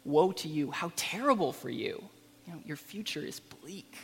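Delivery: sorrowful lament trailing off; compassionate grief. Shown as a demonstration. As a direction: mourn for them, not condemn them. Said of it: "room to see compassion in Jesus' attitude".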